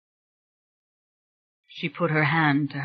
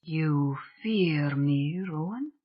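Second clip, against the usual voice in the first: softly voiced spirit; 5 dB quieter, slower than usual. A narrator's reading. Spirit figure voice.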